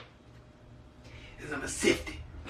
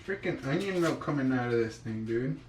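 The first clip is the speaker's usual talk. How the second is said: ordinary talk, nothing marked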